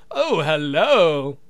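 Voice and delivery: usual speaking voice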